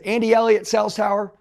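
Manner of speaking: loud, high-pitched summons; retold paging call. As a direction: page him loudly across the store. Manager pages him a second time.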